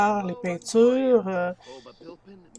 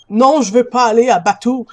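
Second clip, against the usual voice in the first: much louder, higher burst; loud refusal; 4 semitones higher, 13 dB louder than usual. Real, not acted. Player refusing a game prompt mid-explanation, loud protest. Sudden protest during play.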